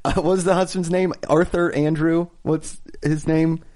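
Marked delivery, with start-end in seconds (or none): none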